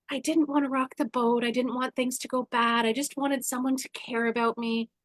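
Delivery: ordinary talk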